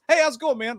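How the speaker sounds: breezy friendly-cop voice. Retold or imitated. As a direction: imitate an easygoing officer saying hello.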